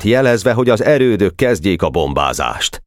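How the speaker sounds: usual speaking voice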